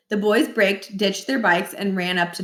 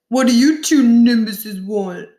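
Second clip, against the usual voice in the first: put-on rude teen; 3 semitones higher, 6 dB louder, slower than usual. A narrator's reading. Josh voiced rudely at door.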